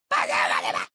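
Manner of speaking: rough, rushed mock shout; exasperated mock yell. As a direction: imitate losing patience completely. Retold exasperated repeated demand.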